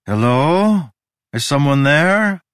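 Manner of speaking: calling out. Acted. Following music down the hall, calling out. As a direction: call out to find whoever might be there. Lone narrator calls out.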